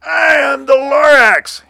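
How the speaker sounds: high, loud, drawn-out cartoon cry; big high-pitched proclamation; indignant Lorax creature. A narrator's reading. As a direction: announce yourself in a piercing creature voice. Creature announces itself in story.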